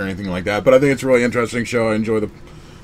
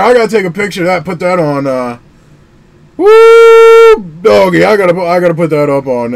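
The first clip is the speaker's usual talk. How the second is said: excited rush; 8 semitones higher, 9 dB louder than usual. Real, not acted. Rushing to post the card.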